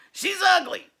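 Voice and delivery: put-on nasty monster boy. Sammy snarls at Leslie, loud and nasty. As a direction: snap it like a rotten little creature.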